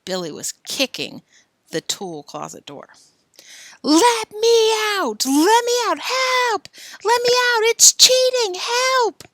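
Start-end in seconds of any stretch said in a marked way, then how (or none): 3.8-9.2 high, loud, slow yells; trapped boy yelling. Acted. Billy yelling from the locked closet, high and loud. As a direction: bang on the door and yell. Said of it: "he chanted rhythmically".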